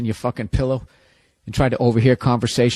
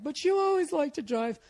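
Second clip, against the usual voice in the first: put-on high girlfriend voice; 13 semitones higher, 6 dB quieter than usual. Girlfriend voiced in breakup bit.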